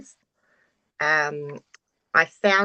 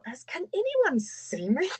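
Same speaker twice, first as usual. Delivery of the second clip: high, soft and slowed; half-embarrassed, wondering if anyone noticed; soft, slow, high mock worry; sheepish inner question; 10 semitones higher, 5 dB quieter than usual. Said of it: "I thought".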